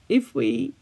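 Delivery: usual speaking voice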